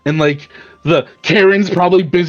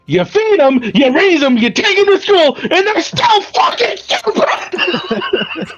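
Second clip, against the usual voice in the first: exasperated yell; 12 semitones higher than usual. Acted. Bear shaking the sheep, shouting in frustration. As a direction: yell it like a fed-up parent at a dumb sheep. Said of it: "He's like".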